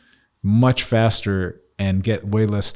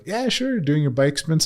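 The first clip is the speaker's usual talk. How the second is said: high, mocking imitation of Kate; playful imitation of a friend; imitating Kate; 8 semitones higher than usual. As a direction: tease her in a lilting voice. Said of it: "She was like".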